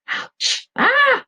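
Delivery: sharp high yelp of pain; pained cry. Acted. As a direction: cry out stepping on stones. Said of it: "I cry".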